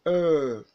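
loud, sustained "Er" with a falling tune; long er vowel. Shown as a demonstration. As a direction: model "Er" for repeating, held long and forceful. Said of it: "lips are compressed and protruded".

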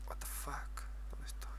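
hushed startled whisper. Real, not acted. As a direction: whisper it under your breath. Startled alone in new place.

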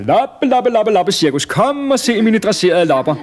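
high, loud sing-out to the crowd; fairground barker shout. A narrator's reading. Man outside his tent touting fleas, high barker's shout. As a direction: holler like a flea-circus showman. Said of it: "stod en mand og råbte".

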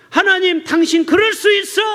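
high, loud furious yell at God; angry shout at God. Retold or imitated. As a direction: hurl the accusation at the sky. Acted outburst at God.

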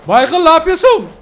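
high, loud astonishment; shocked shouting. Real, not acted. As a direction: blurt it out in shock. Shocked at car throwing someone.

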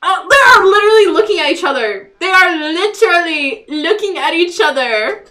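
loud gleeful exclaiming; excited. Real, not acted. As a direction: gush with excitement. Excited squealing at game.

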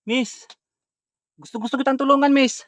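loud, high call into the dark. Real, not acted. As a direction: call out to someone hiding. Calling out to a hidden woman.

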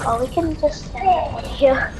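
normal talking voice

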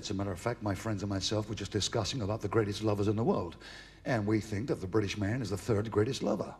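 put-on low, soft American accent; American woman. American woman telling a British man about lovers, low American accent. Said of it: "You're American".

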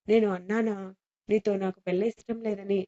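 ordinary delivery, nothing marked

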